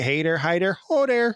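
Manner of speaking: hammed-up Wisconsin catchphrase; Charlie Berens. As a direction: sing out a comic Wisconsin greeting. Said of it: "doing it in the Wisconsin accent".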